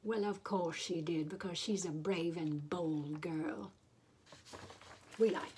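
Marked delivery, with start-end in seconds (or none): none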